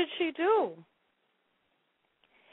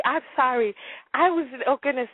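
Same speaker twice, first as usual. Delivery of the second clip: ordinary talk, nothing marked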